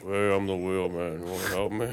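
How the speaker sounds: imitating a wooden actor; Frankenstein monotone. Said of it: "talks and sounds like Frankenstein".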